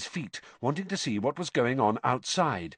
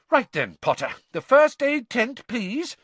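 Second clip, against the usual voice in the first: brisk teacher; 12 semitones higher, 5 dB louder than usual. A narrator's reading. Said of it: "said Professor McGonagall".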